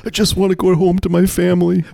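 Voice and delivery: high mock-desperate plea; pleading under duress. Mascot begging to go home to family, mock desperate. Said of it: "He looks under duress".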